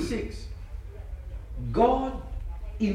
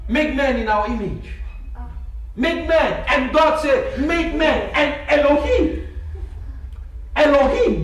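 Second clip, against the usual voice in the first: loud, fervent sermon delivery; preaching; 5 semitones higher, 5 dB louder than usual. Acted. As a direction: preach it with rising fire. Preaching at full force.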